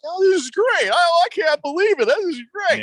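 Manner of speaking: high, gushing delight; giddy excitement. Retold or imitated. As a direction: gush like a thrilled newcomer. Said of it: "everybody's like".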